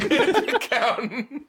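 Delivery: slow, high words through laughter; laughing. Real, not acted. Friend echoing the joke, laughing. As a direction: squeeze it out while cracking up.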